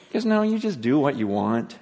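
normal talking voice

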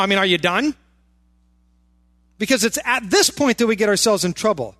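ordinary delivery

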